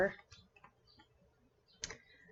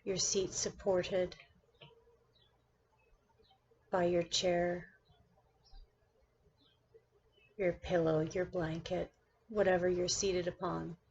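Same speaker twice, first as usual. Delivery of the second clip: measured meditation-guide delivery with long pauses between phrases; guided meditation. Acted. Teacher guiding her class through seated meditation, guided meditation voice. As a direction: lead the listener into stillness, one phrase at a time.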